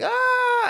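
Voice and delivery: shrill mock scream; short high yelp of fright. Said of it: "she's like".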